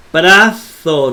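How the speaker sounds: brazo with a long, held z; wrong doubled consonant. Shown as a demonstration. Teacher rejecting lengthened double letters, brazo drawn out. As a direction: stretch the middle of brazo.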